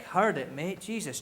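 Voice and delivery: ordinary talk, nothing marked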